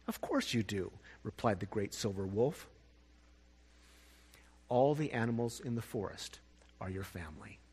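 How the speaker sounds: soft, low wolf voice; gentle reassurance. Acted. Wolf gently tells the bunny he has family, soft low voice.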